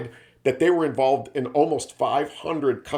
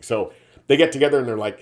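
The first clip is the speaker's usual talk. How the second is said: ordinary delivery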